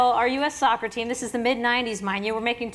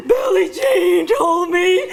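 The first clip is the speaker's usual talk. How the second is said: higher, louder, slow crying-out shout; excited outcry; 6 semitones higher, 8 dB louder, slower than usual. Retold or imitated. Foudy telling her soccer team, slow excited crying shout. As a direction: blurt it out half-crying with excitement. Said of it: "And I was like".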